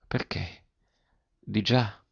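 barely voiced, stunned stammer. A narrator's reading. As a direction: falter out each question in shock. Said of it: "balbettò".

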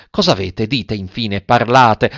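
plain reading voice

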